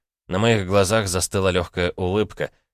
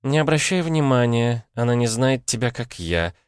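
sultry; 6 semitones higher than usual. Acted. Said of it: "томным голосом проговорила Пхилу".

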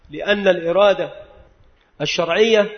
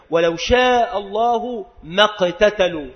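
slow Quran recitation; slower than usual. Shown as a demonstration. Quran verse recited in lesson.

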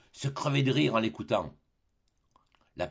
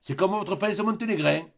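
louder, higher lecturing skipper; captain lectures the fooled Tartarin, louder and higher; sententious captain; 8 semitones higher, 3 dB louder than usual. Acted. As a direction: lay down the lesson like a know-all sailor. Barbassou continues.

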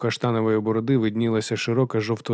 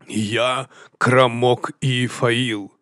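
very slow, drawn-out announcement; grand self-introduction; 2 semitones higher, much slower than usual. Acted. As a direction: stretch the name out proudly. Chieftain grandly introduces himself.

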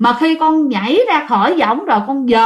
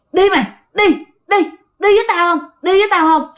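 a raised, higher ghostly voice calling him; 7 semitones higher, faster than usual. Retold or imitated. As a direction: lure him out, voice pitched up high. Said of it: "cái người đó hỏi rằng".

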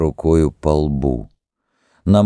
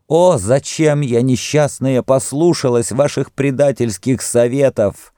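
anguished cursing, higher pitch; heart-rending cry; 7 semitones higher than usual. A narrator's reading. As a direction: cry out in bitter grief and reproach. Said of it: "закричала душераздирающим голосом". (душераздирающий крик отчаяния)

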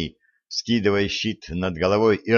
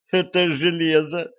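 laughing; 8 semitones higher than usual. Acted. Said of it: "хохотнула девушка".

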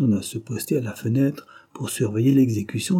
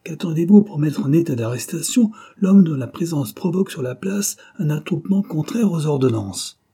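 higher, louder rebuke; angry order down the telephone; rough; 6 semitones higher, 4 dB louder than usual. A narrator's reading. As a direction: demand it like an impatient commander. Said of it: "fit-il rudement".

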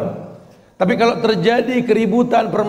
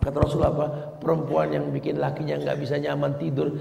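ordinary delivery

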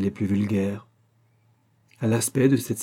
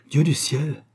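higher-pitched cry of dismay; shocked exclamation; 6 semitones higher, slower than usual. A narrator's reading. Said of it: "s'écria Onesta". (exclamation indignée, plus aiguë)

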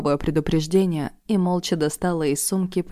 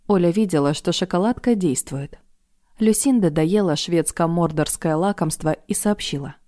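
ordinary delivery

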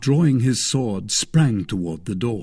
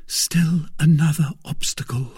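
higher woman's voice, a touch of sorrow; mildly sorrowful, pitched up; sorrowful murmur; 6 semitones higher than usual. A narrator's reading. Woman raising another obstacle to her protector, mildly sorrowful. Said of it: "murmured Madame Bonacieux sorrowfully".

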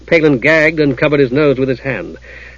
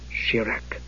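very quiet word of magic; softly; 3 semitones lower, 15 dB quieter than usual. A narrator's reading. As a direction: speak the spell very softly.

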